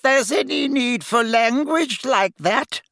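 high, slow and prim, a fussy seamstress; fussy shopkeeper witch. A narrator's reading. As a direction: play her as a flustered but proper shop owner. Madame Malkin's voice.

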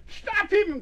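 high-pitched mother's cry to stop him; alarmed cry. A narrator's reading. Mother to Pogo as her child runs away, alarmed cry. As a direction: cry out high in alarm, without much extra volume. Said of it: "cried Missus Nibble".

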